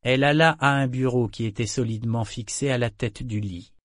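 plain reading voice

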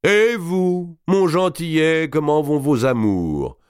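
high, slow mocking greeting; hearty count teasing a youth; brash lord teasing. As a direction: tease the young man. Robert greets Guccio.

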